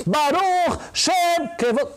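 Baruch Shem Kevod chanted high and loud, slowly. Retold or imitated. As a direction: proclaim the line aloud, drawn out and high. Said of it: "a gente faz a Baruch Shem Kevod Malchuto alto". (dito em voz alta, agudo e lento)

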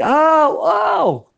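sharp high pained cries; loud high ouch; cry of pain. Acted. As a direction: yelp as if kicked. Man groans falling on matzot.

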